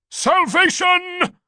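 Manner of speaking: very high, loud, drawn-out cry; dying cry. A narrator's reading. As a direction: stretch the word out in ecstasy. Dying priest cries out.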